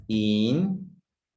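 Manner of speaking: "Intensidad" broken into in, ten, si, dad; long pauses between each syllable of "Intensidad". Shown as a demonstration. Teacher splitting a word for pupils, very slow separated syllables. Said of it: "separar una palabra en sílabas".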